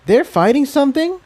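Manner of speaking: high, alarmed little filly; a small pony asking in alarm; young filly voice. Acted. Tiptoe asks, surprised.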